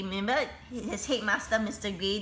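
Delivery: usual speaking voice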